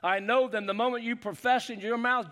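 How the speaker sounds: ordinary talk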